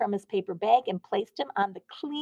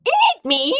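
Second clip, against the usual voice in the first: high, loud shriek; a donut shrieking at the man who meant to eat him; 12 semitones higher, 11 dB louder than usual. A narrator's reading. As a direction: shriek it in horror. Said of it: "Arnie shrieked".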